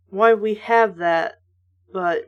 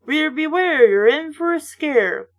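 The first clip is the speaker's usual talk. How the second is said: spooky tagline; 4 semitones higher than usual. Acted. Announcing the series slogan, mock spooky. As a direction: sell the creepy catchphrase.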